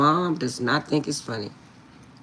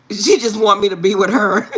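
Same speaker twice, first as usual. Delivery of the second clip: ordinary talk, nothing marked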